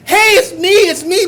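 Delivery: high, loud eager call; excited calling out. Retold or imitated. Calling from the car to the old man, high and excited. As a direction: call out like you've found your idol. Wants to greet his hero.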